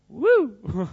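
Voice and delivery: high, loud "woo!"; extrovert's whoop. Acted. Speaker imitating extroverts for the audience, high excited whoop. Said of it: "extroverts are always like".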